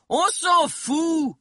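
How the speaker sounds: very high, drawn-out cry; stretched, high-pitched jeer; shouted heckle. A narrator's reading. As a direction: cut in with an impatient yell. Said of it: "cria Steve".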